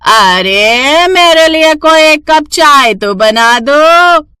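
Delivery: high, slow, drawn-out demanding mother-in-law; lazy mother-in-law. Acted. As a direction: stretch out the lazy request to the daughters-in-law. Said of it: "सास फिर से उन्हें कुछ न कुछ काम बता देती". (ऊँची, धीमी, खिंची हुई आलसी सास की आवाज़)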